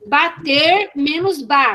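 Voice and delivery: slow, stretched BATER menos BA; measured teacher dictation; syllable demonstration. Shown as a demonstration. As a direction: give each syllable room for the class. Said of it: "manipulação silábica".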